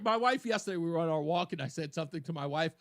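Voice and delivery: usual speaking voice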